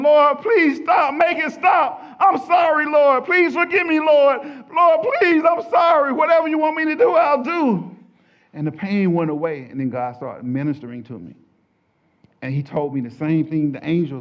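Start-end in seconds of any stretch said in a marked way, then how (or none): 0.0-8.0 very high, shouted begging; desperate pleading. Retold or imitated. Sinner begging the Lord to stop the pain, desperate high cry. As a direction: beg at full force for it to stop.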